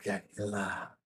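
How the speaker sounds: hushed, distant mystic whisper; slow secretive whisper from nowhere; whispering voice in the head. Acted.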